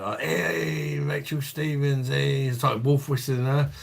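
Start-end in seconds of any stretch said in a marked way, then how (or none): 0.2-2.5 retold call-out. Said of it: "somebody shouted out".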